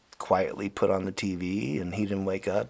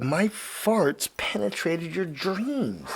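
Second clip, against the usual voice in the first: mock grand line; 7 semitones higher, slower than usual. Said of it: "and I said".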